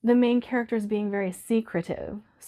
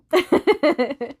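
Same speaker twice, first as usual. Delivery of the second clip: laugh; 7 semitones higher, 6 dB louder than usual. Real, not acted. Laugh after crying.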